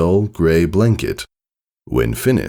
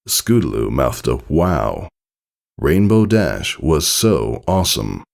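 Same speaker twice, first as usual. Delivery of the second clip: plain reading voice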